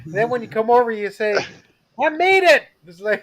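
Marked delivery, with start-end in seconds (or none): 2.0-2.6 mock triumphant call, higher and louder; playful "made it" announcement; retold arrival call. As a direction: chirp it like you just arrived.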